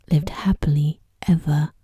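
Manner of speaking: ordinary delivery, nothing marked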